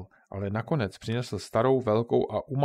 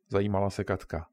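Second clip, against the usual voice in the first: ordinary delivery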